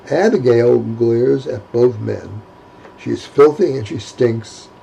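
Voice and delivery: usual speaking voice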